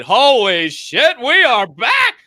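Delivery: high, loud hollering celebration; ecstatic shout. Retold or imitated. Fan retelling waking up to [name]'s return, ecstatic shout.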